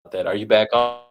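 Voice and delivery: usual speaking voice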